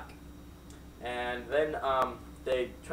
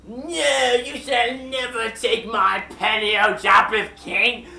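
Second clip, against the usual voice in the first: slow, louder, higher heroic declaration; defiant yell; 5 semitones higher, 8 dB louder, slower than usual. Acted. Joe refuses to give the king his penny, in a slow, loud heroic yell. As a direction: stand firm and yell it like a storybook hero. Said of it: "And he yelled at the Japanese king".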